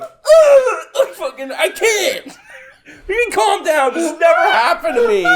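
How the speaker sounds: high, laughing, half-shouted panic; mock panic. Winded kid answering the calm teacher, high laughing mock panic. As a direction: play your own childhood terror for laughs.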